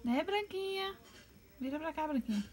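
high cooing call to the bird; high pet voice calling Branquinha; baby talk to pet. Acted. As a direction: coax the bird to turn around.

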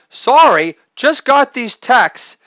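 high, put-on work buddy voice; work buddy's text. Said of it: "Old work, bud text".

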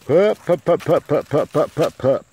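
high, loud repeated pup calls; calling puppies. Real, not acted. Owner calling the puppies over, high and loud. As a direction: chirp it out to bring the pups running.

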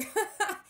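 a quick high burst of laughter; short laugh. Real, not acted. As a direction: laugh at your own misery. Laughs at her own aching feet.

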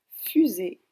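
"fusée" avec le f qui dure, fffusée; f initial allongé. Shown as a demonstration. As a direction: appuyer lentement sur le début de "fusée". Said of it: "les lettres qui font f". («fusée» plus fort, en étirant le f)

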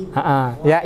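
ordinary talk, nothing marked

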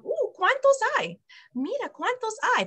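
put-on bright, sing-song parent counting with a child; cheerful parent voice. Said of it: "and ask them".

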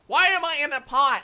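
exasperated yell. Real, not acted. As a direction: yell it in baffled frustration. Exasperated at where they landed.

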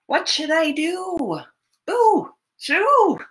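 put-on storyteller shoos the bear for the children; shooing call. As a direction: shout to chase the bear away.

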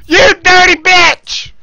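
drawn-out, high-pitched curse; angry yell. Real, not acted. Gamer cursing the player who got them, angry yell. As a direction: stretch it out in a furious yell.

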